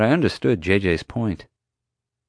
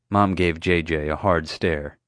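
usual speaking voice

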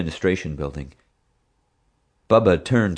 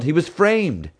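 yelling; 11 semitones higher, slower than usual. Acted. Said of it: "Dad yelled".

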